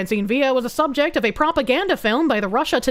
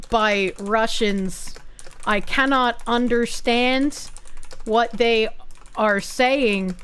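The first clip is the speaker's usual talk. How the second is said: slow message read; much slower than usual. Acted. Reads donation message aloud slowly.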